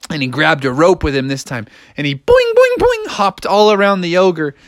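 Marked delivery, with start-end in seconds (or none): 2.2-3.1 high, springy boings; boing like a spring, high up; bouncing noises. Acted. Imitates hopping.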